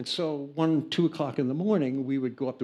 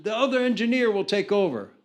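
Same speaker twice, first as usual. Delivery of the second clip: high, loud hammed-up exit; overdramatic; 10 semitones higher, 8 dB louder than usual. Jack telling the band he must leave, hammed-up and high. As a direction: announce leaving with fake urgency. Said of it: "he's being overdramatic".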